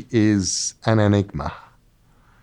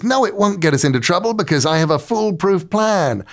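cocky Loki; 7 semitones higher than usual. Retold or imitated. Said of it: "And he goes".

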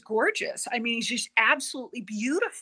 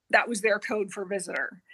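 whispered aside. Real, not acted. Explaining the emblem's meaning.

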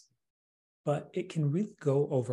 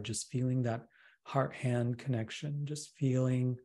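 guided meditation. Acted. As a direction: gently lead them into relaxation.

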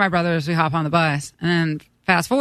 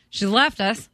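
normal talking voice

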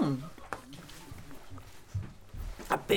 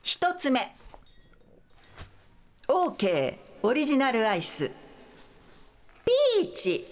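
announcer; 4 semitones higher than usual. Acted. Host announcing product names.

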